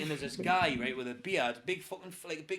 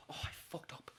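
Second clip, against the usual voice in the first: very soft, nearly whispered admission; guilty confession; 13 dB quieter than usual. Retold or imitated. Flatmate confessing to his new housemate, guilty. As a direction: confess it under your breath.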